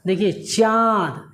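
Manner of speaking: slow चाँद, the vowel voiced through nose and mouth together; teacher showing the anunasik sound in चाँद; nasal chand demo. Shown as a demonstration. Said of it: "मुंह की भी हवा ... नाक का भी प्रयोग".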